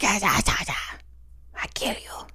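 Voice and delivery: croaky, whispered attempt to speak; lost voice. Telling co-host how sick she was, lost voice. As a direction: imitate having no voice, barely any sound coming out. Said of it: "I didn't even have a voice".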